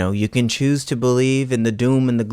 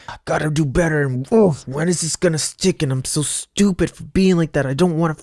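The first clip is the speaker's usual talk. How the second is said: higher, whiny voice beating yourself up; self-berating inner voice; 5 semitones higher than usual. Retold or imitated. Scolding yourself for not doing better, whiny self-critic. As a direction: act out the anxious critic in your head. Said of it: "you don't have to choose in the space of, like".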